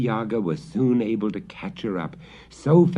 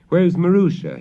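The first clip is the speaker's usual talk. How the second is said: higher, louder father's question; worried father; 9 semitones higher, 7 dB louder than usual. Acted. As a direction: call out for the missing girl. Father asks for daughter.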